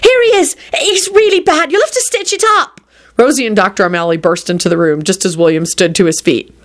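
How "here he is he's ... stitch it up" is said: very high, anxious urging; urgent alarm. A narrator's reading. Rosie bringing the doctor to William, high and urgent.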